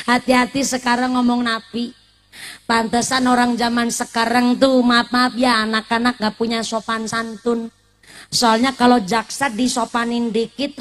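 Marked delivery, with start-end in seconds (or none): none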